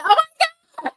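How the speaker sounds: high, loud shocked gasp of words. Retold or imitated. Caught out when the truck starts after gas, high shock. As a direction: shriek it in sudden disbelief.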